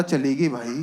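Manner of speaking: low, soft heartbroken lament; mock tearful. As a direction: play a dumped man, voice sunk low. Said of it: "रो रहा है।". (रुआँसी, दबी आवाज़ का अभिनय)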